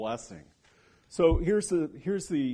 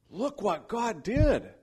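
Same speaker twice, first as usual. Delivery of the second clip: higher, slow, awed exclamation; amazed report; 9 semitones higher, 4 dB louder, slower than usual. Retold or imitated. Widow telling Elijah of the miracle, slow and awed. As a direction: hold up the wonder of it, wide-eyed.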